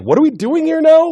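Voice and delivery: a character thrown into the wrong movie, confused; baffled outburst. Retold or imitated. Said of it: "All of a sudden, like".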